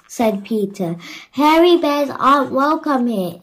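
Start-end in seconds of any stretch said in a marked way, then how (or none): 1.3-3.4 louder, firm insistence; insistent. A narrator's reading. The mayor dismissing Peter, firm and insistent. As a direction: refuse the Yeti flatly. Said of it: "insisted the mayor".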